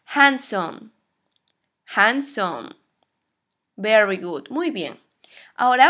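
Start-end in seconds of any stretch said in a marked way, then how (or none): 0.1-0.9 HANDSOME in two clear, separated beats, falling; model pronunciation. Shown as a demonstration. Teacher asks class to listen and repeat, careful model pronunciation. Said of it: "escuchen bien cómo se pronuncia". 1.9-2.7 HANDSOME lower, in two clear beats; model pronunciation. Shown as a demonstration. Teacher models for class to repeat, careful low model pronunciation. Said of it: "escuchen bien cómo se pronuncia".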